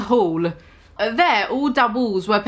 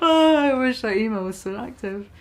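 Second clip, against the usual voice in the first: laughing tears. Real, not acted. Crying with laughter aside.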